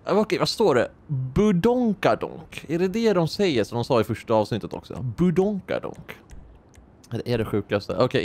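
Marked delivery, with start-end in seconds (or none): 1.1-2.6 silly word. Pretend. 5.2-6.2 silly word. Pretend.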